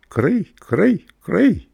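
high chirping cricket sounds. A narrator's reading. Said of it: "Ein Zirpsen".

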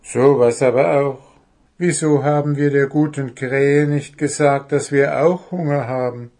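lightly voiced kobold, slightly dismayed; kobold's realisation. Kobold tells Puh they forgot their hunger. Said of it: "fiel Wuschel nach einer Stunde ein".

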